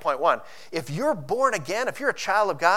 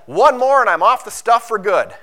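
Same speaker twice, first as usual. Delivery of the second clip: mock addict's promise; 4 semitones higher, 9 dB louder than usual. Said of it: "the old adage".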